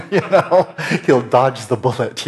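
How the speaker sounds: can't help laughing mid-line; laughing at the dodged question. Real, not acted. Laughing at his own joke.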